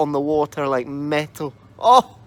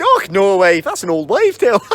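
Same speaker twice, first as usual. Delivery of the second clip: louder, scoffing husband voice; dismissive husband; 5 semitones higher, 8 dB louder than usual. Acted. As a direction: brush her off.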